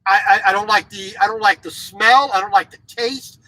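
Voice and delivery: ordinary talk, nothing marked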